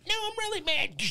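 squeaky high mock-mad voice; mock giant. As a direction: play the giant with a tiny high voice. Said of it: "He's gonna grab my big toe and go".

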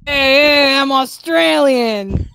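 drawn-out bogus Australian accent. Shown as a demonstration. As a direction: put on a slow, silly Australian accent. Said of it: "Can you do, uh, Australian?".

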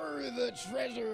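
mock pirate cry. Shown as a demonstration. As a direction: ham it up like a pirate newbie. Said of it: "put it all together".

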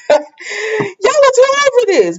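high, slow, exasperated scolding; exasperated viewer scolding the characters. Real, not acted. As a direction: drag it out high in disbelief. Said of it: "I'm just like".

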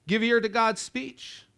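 ordinary talk, nothing marked

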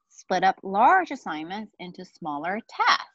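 ordinary talk

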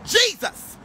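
Jesus called out high; high preaching cry of Jesus. Real, not acted. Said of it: "when I say Jesus".

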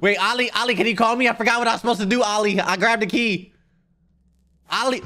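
high, loud panicked pleas; panicked calling. Real, not acted. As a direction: call for help, frantic and high.

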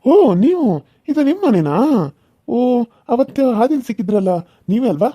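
high, bright-eyed greeting; light up on seeing her; delighted surprise. Acted. Suitor delighted to see her. (ಖುಷಿಯ ಅಚ್ಚರಿ)

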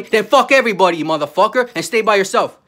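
loud furious outburst; loud angry yell at the people who wronged him; angry shout. Real, not acted. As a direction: yell it at them in real anger. Angry rant at others.

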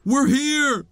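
high, loud playful announcement; big, high arrival shout; calling out. Pretend. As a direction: call out cheerfully to scare them. Announcing arrival to game creatures.

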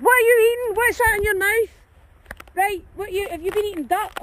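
high, loud alarmed question to the dog; sharp worried call. Real, not acted. Dog found something to eat.

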